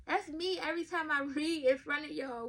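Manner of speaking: usual speaking voice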